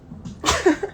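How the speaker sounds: short high laugh. Real, not acted. Woman amused by her late self-discovery, high laugh.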